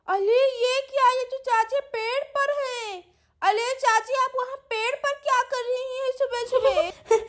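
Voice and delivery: put-on high childlike voice with baby-talk sounds; puzzled little Sweety. Sweety finding her aunt up a tree, puzzled child voice. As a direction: wonder aloud as a baffled little child.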